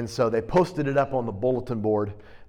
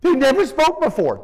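high and louder, full of wonder; amazed emphasis; 11 semitones higher, 6 dB louder than usual. Acted. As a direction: marvel that the mute speaks. Marvels at the healed mute.

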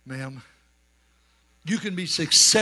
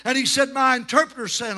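raised, driving revival-preacher voice; fervent preaching; 7 semitones higher, 4 dB louder than usual. Real, not acted. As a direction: preach it hard without letting up. Preaching at full force.

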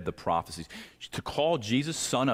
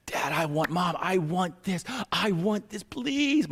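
put-on whiny kid voice climbing higher to a high please; begging child; 8 semitones higher than usual. Child begging Mom and Dad for presents, high and whiny. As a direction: wheedle like a kid at Christmas.

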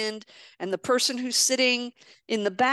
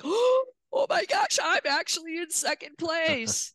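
a gasp, then a much higher, slow, stretched oh my gosh; stunned joy at overtaking a champion, pitched way up and drawn out; amazed gasp; 7 semitones higher than usual. Retold or imitated. Realizing she is second in race.

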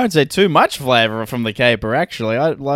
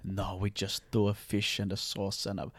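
hushed, snooty restaurant impression; soft, lower mock-fancy waiter; fancy Italian restaurant staff; 6 semitones lower, 11 dB quieter than usual. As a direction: drop to a near-whisper, trailing between dishes. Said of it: "Really fancy Italian".

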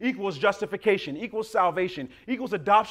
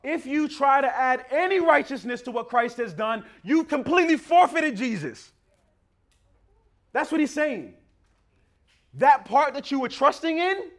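loud, higher fiery sermon; preaching at full force; 4 semitones higher, 5 dB louder than usual. Acted. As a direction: drive the warning home to the congregation.

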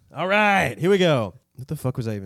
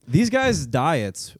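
hyped-up announcement; 8 semitones higher, 7 dB louder, slower than usual. Acted. Hyping up the Mongol diet.

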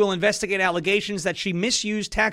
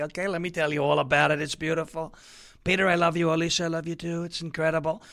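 soft, mushy mock lovers' voice; mock lovestruck couple; 4 semitones lower, 6 dB quieter than usual. Host mocking the FBI lovebirds, soft syrupy coo. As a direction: coo it like swooning sweethearts. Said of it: "Remember the FBI lovebirds".

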